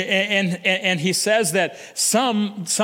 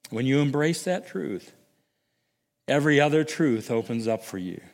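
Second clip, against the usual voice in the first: ordinary talk, nothing marked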